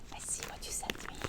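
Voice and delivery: hushed whisper; whispered anticipation. Real, not acted. Opening a viewer's package, a hushed whisper. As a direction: whisper as if sharing a secret.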